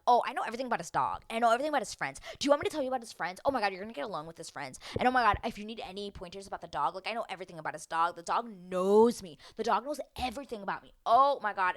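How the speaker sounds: fast, excited run-on boasting; gushing ramble. Retold or imitated. Lydia bragging to Aaliyah about his dog, gushing. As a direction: rattle it off like an unstoppable overeager know-it-all. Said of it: "she just starts going down this hole".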